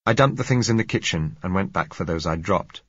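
plain reading voice

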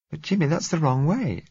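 put-on alarmed girl protesting; anxious protest. A narrator's reading.

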